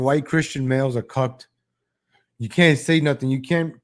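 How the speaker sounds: normal talking voice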